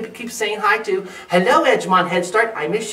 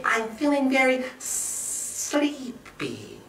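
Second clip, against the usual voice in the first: very slow, soft, higher snake voice; sleepy murmuring snake; 6 semitones higher, 6 dB quieter, much slower than usual. A narrator's reading. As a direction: draw every word out drowsily. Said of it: "murmured Snake".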